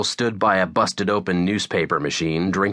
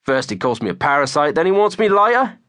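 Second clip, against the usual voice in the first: mock-outraged fake British accent, climbing high and louder; Jezza; 10 semitones higher, 4 dB louder than usual. A narrator's reading. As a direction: play wounded outrage in bad cartoon British. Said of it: "Jezza mimed outrage".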